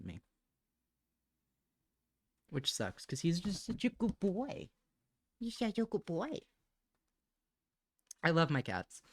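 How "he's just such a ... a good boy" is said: higher doting coo; cooing about her cat, higher doting voice. Real, not acted.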